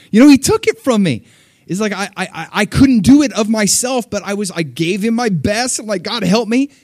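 high, loud preaching surge; fervent sermon climax; full-force preaching. Acted. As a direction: drive the testimony home.

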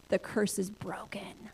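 slow, breathy whisper of a holy word; whispered divine message; hushed whisper. Retold or imitated. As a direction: hush it like a sacred secret. God's words relayed quietly.

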